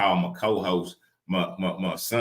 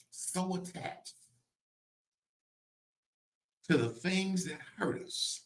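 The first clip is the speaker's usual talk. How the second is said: high, much softer, slow; hushed preaching; 11 semitones higher, 9 dB quieter, slower than usual. Real, not acted. Preacher on the things that hurt us, hushed preaching. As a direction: pull back to an intense hush.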